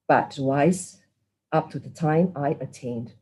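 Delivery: solemn prayer voice; merit-sharing prayer. Acted. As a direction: recite it with devotion, line by line.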